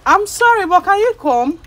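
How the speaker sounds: high, loud apologetic call; urgent plea. Real, not acted. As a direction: plead loudly for them to come over. Urgently calling a reluctant woman over.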